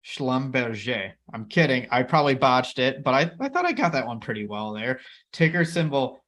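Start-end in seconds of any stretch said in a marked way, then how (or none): 0.0-1.1 "Schlumberger" slowly as shlum-behr-ZHAY; French-style pronunciation. Shown as a demonstration. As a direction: repeat "Schlumberger" with a drawn-out French zhay ending. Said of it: "I know how to pronounce this company's name correctly".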